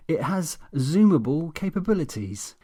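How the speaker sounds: smug, self-satisfied boast; pleased-with-himself official tone. Acted. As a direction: say it with a smug grin. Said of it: "with an intensely smug grin".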